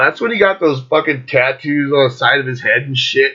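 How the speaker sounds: ordinary talk, nothing marked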